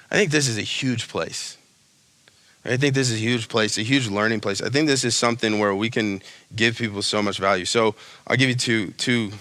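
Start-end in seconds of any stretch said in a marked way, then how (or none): none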